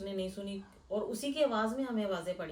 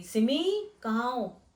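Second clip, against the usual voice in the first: raised, higher call to someone out of sight; loud calling out; 4 semitones higher, 8 dB louder, much slower than usual. Retold or imitated. Mother calls to her daughter in another room, loud calling out. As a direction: call out for her, imitating the shout. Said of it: "मैंने उसको ज़ोर से आवाज़ डाल".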